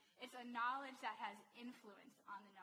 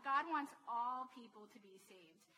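ordinary delivery

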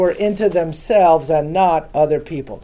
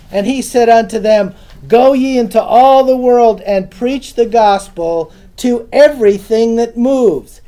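louder, each phrase driven home; emphatic scripture reading; 3 semitones higher, 5 dB louder than usual. Shown as a demonstration. Pastor rereading the verse to the flock, emphatic. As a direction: preach it with force. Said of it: "figure adds emphasis".

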